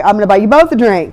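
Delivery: loud, exasperated bartender voice; fed-up bartender. Retold or imitated. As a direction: settle it like a bartender who's had enough. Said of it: "the bartender's like".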